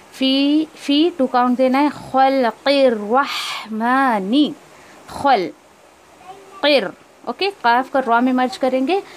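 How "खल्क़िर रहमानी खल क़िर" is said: खल्क़िर Rahmani drawn out slowly; slow recitation. Shown as a demonstration. Teacher recites the phrase for students. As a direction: recite the phrase, stretched and measured.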